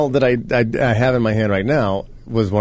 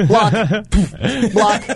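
playful; 10 semitones higher, much slower than usual. Acted. Acting out volleyball blocks.

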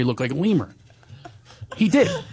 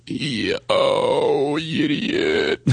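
inhale version; much slower than usual. Shown as a demonstration. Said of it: "That's the inhale version".

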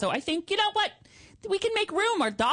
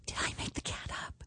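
soft eerie whisper of doubt; hushed, spooked whisper; 12 dB quieter than usual. Real, not acted. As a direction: whisper it as if afraid of the answer. Wonders if cat was real.

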